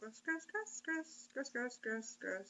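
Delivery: very soft, muttered disgust; disgusted murmur. Real, not acted. Co-host softly mutters gross over and over under the reading.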